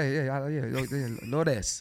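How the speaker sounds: put-on slow, drawn-out Spanish accent on Lourdes; playful put-on Spanish accent. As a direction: roll Lourdes out the Spanish way, a bit hammed. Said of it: "Say it with an accent".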